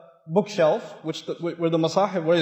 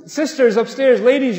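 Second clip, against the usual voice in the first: ordinary talk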